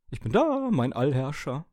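sehr hohe, laute Dosenstimme; hoch und ergeben melden; Konservendose. A narrator's reading. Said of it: "wiederholte die Konserve". (singend hohe, unterwürfige Dosenstimme)

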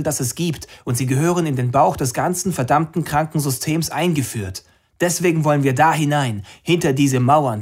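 high, loud rally speech to a crowd; impassioned activist addressing the masses; rousing protest speech. Acted. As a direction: whip up the crowd from a rooftop. Anna's speech continues.

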